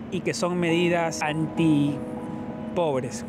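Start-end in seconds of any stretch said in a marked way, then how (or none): none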